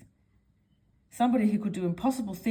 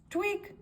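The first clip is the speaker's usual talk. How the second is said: tweak sound; 12 semitones higher, 3 dB quieter than usual. Acted. Sound effect of nose tweak.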